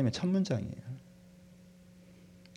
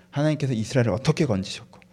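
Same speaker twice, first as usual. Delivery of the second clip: usual speaking voice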